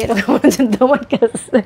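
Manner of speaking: slow words through laughter; real laugh breaking into the line. Real, not acted. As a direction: laugh at your own breathlessness as you speak. Laughing over being out of breath.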